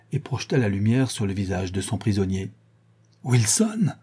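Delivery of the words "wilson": restrained high-pitched cry of shock; terrified cry. A narrator's reading. Sholmes recognises his friend as his prisoner, terrified cry. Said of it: "hurla-t-il, terrifié".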